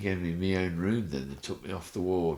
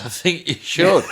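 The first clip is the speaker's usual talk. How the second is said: much higher, louder, laughing; laughing retort; 11 semitones higher, 12 dB louder than usual. Retold or imitated. Replying to the main nurse with a laughing joke. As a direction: laugh the comeback out.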